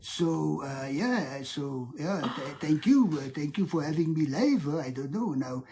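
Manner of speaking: hesitant put-on French accent. Shown as a demonstration. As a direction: ramble in a mock French accent. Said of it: "I can do a French accent".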